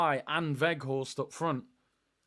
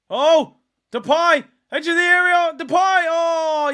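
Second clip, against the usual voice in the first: loud, high chance call; sudden rising yell at the chance; excited shout; 11 semitones higher, 10 dB louder than usual. Real, not acted. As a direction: roar as Depay shapes to shoot. Chance on goal, live reaction.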